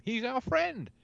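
ordinary talk

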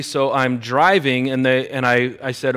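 ordinary delivery

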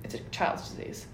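a breathy, near-whispered aside; hushed doctor quote. Retold or imitated. Telling friends the doctor's verdict, half-whispered. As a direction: drop it to a soft, unvoiced deadpan.

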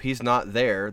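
ordinary delivery

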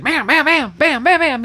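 rapid-fire bams, pitched high; imitated screen effect. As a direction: fire off high "bam"s like quick cuts.

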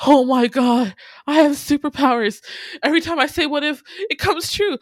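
high, loud, breathless-sounding mock amazement; hammed-up excited tirade. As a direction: rave as Iggy discovering superpowers, way over the top. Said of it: "goes into this whole tirade".

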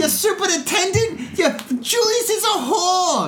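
high, slow, screeching mother; shrill Goodfellas mom. Retold or imitated. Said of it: "the mom in Goodfellas".

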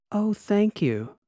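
high, slow, dripping sarcasm; sarcastic thanks. A narrator's reading. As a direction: drag it out mockingly. Sarcastic reply to robot.

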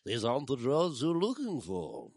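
put-on light British Obi-Wan accent; mock Jedi mind trick line; Obi-Wan impression. Joking about the show, a light British Obi-Wan accent. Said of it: "you didn't go".

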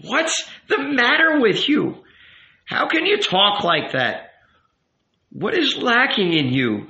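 high, agitated rabbi voice; exasperated rabbi. A narrator's reading. Rabbi appalled at the youth's coldness, exasperated. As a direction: demand answers as an appalled elder.

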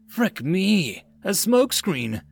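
startled at the smoke; surprised cry. A narrator's reading. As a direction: soldier caught off guard, crying out. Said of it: "Mills cried out in surprise".

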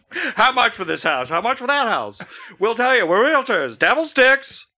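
realtor commercial voice. Pretend. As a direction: sell houses like a TV realtor. Mock realty ad.

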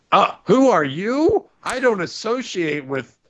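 slow, high and loud snobbish brush-off; snooty. Retold or imitated. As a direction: look down on the referee slowly. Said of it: "Sonya Deville goes".

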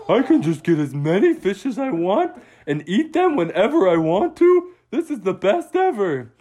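crowing about endless fish; gleeful otter. Acted. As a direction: Otto bragging happily about eating fish. Otto gloats about fish.

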